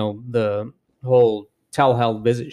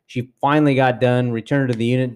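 ordinary talk

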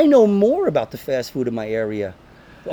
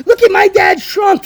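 put-on very high, loud child's plea; excited kid voice; 6 dB louder, slower than usual. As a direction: beg like an eager kid. Voicing kids to strangers.

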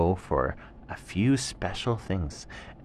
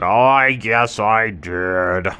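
slow, loud humbled tiger; sheepish tiger; 3 semitones higher, 9 dB louder, slower than usual. Acted. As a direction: admit it slowly. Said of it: "And the tiger said".

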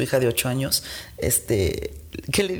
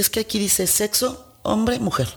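put-on child's answer; 5 semitones higher than usual. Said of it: "Y le dice".

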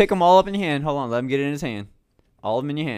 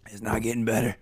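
pained whisper from the heat; hushed groan of pain; strained whisper; 9 semitones lower, 11 dB quieter than usual. Real, not acted. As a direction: breathe the words out, no voice. Hot chip pain, whispered.

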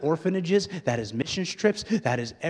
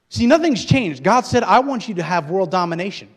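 loud, raised pulpit proclamation; full-force preaching; 7 semitones higher, 13 dB louder than usual. Real, not acted. Preacher announcing that nothing has changed, full-force preaching. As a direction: proclaim it to the whole room.

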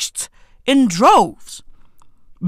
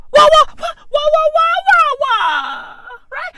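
rapid-fire, very high-pitched disbelief; squawking bafflement. Acted. Comedian failing to understand Merseyside speech, squawking bafflement. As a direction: yelp it over and over way up high.

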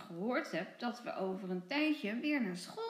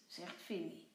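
ordinary delivery, nothing marked

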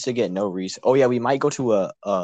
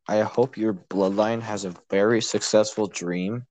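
ordinary delivery

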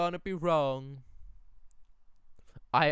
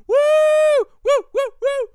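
much higher, much louder woos; ecstatic whoops; 13 dB louder than usual. Real, not acted. Cheering the gazebo kiss with shrieking whoops. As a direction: scream with joy.

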